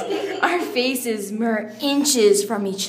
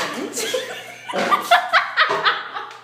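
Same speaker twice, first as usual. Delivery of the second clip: high squealing laugh, falling; high laughing fit; 13 semitones higher than usual. Real, not acted. Reader cracking up at her own writing, squealing laugh. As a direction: burst out laughing.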